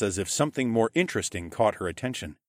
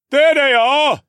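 very high, loud distant shout; full-voiced spotting cry; calling out; 13 dB louder, slower than usual. A narrator's reading. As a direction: yell it to the search party. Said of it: "a voice called out".

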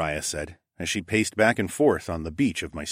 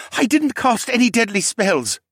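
high, loud protest; insistent; 6 dB louder than usual. A narrator's reading. Captured mage defends himself to captor, insisting loudly. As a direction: protest your innocence, voice pitched high. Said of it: "he insisted".

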